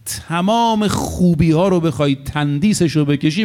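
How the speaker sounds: ordinary delivery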